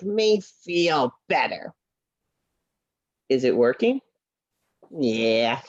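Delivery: low, dry horse puppet voice; a sly little horse who sees through the trick; knowing puppet horse. Acted. Puppet Henry voice.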